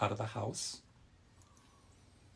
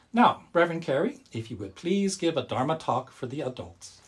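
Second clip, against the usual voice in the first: put-on higher, cheerful farewell to kids; friendly bear talking to children; 4 semitones higher than usual. The Bodhi bear says goodbye to the children, high and cheerful. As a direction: bid the children goodbye brightly.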